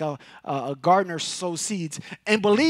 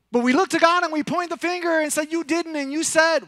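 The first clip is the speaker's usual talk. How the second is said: mock accusation; 10 semitones higher, 6 dB louder than usual. Mocks people blaming God.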